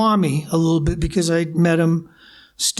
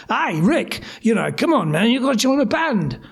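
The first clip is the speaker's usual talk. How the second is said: lively imitation of a chummy English drummer; 3 semitones higher than usual. Drummer phoning to recruit a singer, imitated chummy coaxing. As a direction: coax a pal in a put-on English voice. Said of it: "he's this kind of guy".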